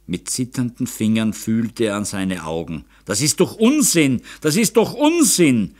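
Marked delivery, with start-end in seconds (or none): 3.0-5.7 high, rising insistence on each repeat; loud, panicky disbelief; frantic denial. A narrator's reading. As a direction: refuse to believe it, more agitated the second time. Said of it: "Ein Dutzend Mal wiederholte er".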